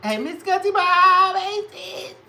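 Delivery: excited high hello; shrill greeting. Acted. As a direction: squeal the greeting.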